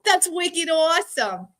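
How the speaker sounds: playful exaggerated Boston accent. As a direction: ham up a Boston accent for a friend's amusement. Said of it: "I talk like this".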